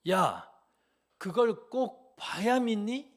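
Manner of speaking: loud, slow furious rebuke; angry parent. Acted. Mother scolding a child who demands proof, loud and furious. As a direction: shout it out slow like a hurt mother.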